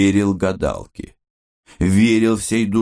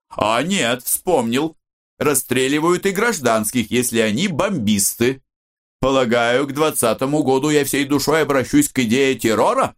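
mildly merry, higher-pitched banter; cheerful joking; 6 semitones higher than usual. A narrator's reading. Yuri joking about his own execution, lightly merry and higher. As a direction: toy playfully with the grim prediction. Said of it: "весёлое расположение духа".

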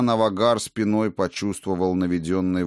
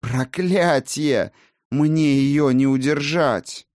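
desperate cry; 7 semitones higher, 4 dB louder, slower than usual. Acted. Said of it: "истошный крик эльфа".

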